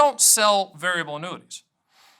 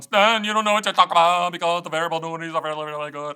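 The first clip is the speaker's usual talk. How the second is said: put-on Carolina accent; 3 dB louder, faster than usual. Rival agent scolding Stan about variable annuities, Carolina accent. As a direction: imitate a gabbling Carolina salesman's accent. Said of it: "I don't know where that accent came from".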